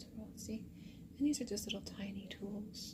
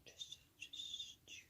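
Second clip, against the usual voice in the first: ASMR whisper; 2 semitones higher, 13 dB quieter than usual. Acted. ASMR artist brushing the listener's ear, whispering softly.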